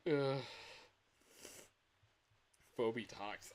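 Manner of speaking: usual speaking voice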